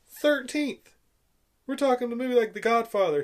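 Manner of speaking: high, loud emphatic repeat; emphatic exclamation. Real, not acted. Reviewer marvels at the ranking of A New Hope, emphatic.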